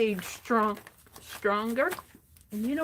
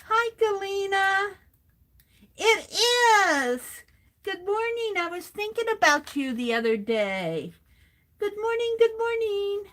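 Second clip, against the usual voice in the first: bright, higher greeting to a viewer; excited greeting; 6 semitones higher than usual. Real, not acted. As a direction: sing out good morning to a friend.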